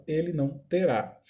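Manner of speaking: usual speaking voice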